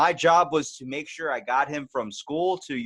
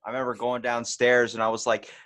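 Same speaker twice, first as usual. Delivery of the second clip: ordinary talk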